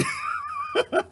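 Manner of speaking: high cackling laugh. Real, not acted. He cackles at his own trailer park joke.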